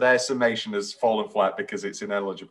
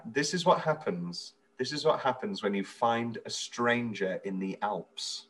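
slow, softer deadpan quote; censored movie line; 8 dB quieter, slower than usual. Retold or imitated. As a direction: quote the tame TV dub, word by word. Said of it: "they change lines so that there's no swearing".

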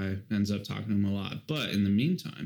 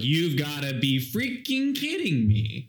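slow, stunned disbelief; Phil, slow and dismayed; exasperated Phil; 4 semitones higher, slower than usual. Retold or imitated. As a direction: drag out the disbelief. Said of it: "Phil was like".

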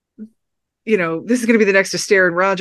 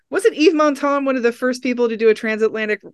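usual speaking voice

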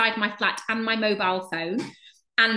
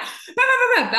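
loud, high babbling rant; mock ranting at them; 5 dB louder than usual. To the casting panel, a loud mock tirade of bah. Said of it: "properly going at them".

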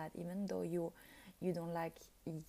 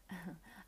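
soft laugh; 8 dB quieter than usual. Real, not acted. Laughs at own remark.

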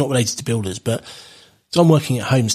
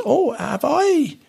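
put-on much higher and slow, a frail old woman's voice; quavery old lady; 12 semitones higher, much slower than usual. Old lady at the door, high and slow. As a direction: play the confused old dear. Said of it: "And she goes".

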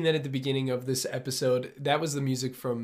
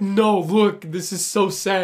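teasing a friend, higher and louder, whiny; hammed-up weepy whine, slowing on this is so sad; mock sad whine; 5 semitones higher, 9 dB louder, much slower than usual. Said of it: "You're like".

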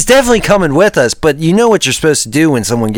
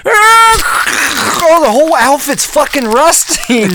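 panicked high shouting; 12 semitones higher, 4 dB louder, slower than usual. Acted. His mask cracked, panicking.

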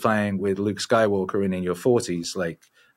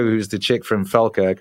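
ordinary talk, nothing marked

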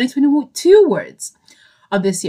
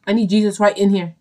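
usual speaking voice

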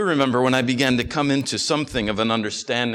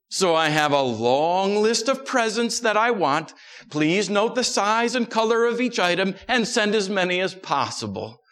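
put-on higher, demanding little girl voice; a greedy little sister, slowing at the end; whiny tattling little sibling; 6 semitones higher than usual. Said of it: "write a letter to Santa for me".

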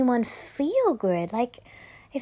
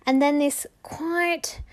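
ordinary delivery

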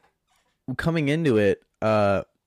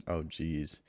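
ordinary talk

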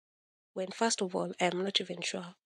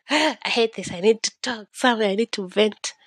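louder, exasperated, imitating herself; frustrated outburst; 3 semitones higher, 7 dB louder than usual. Replaying herself crying over her journal, loud frustrated outburst. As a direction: let the frustration spill out a bit loud.

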